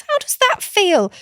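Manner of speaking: high encouraging question. Acted. Aunt asks niece about feelings, high encouraging tone. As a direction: gently prompt her to name the feeling. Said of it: "bring it back to feelings".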